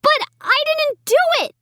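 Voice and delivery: high, pleading wail. A narrator's reading. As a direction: wail out your innocence. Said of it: "I wailed".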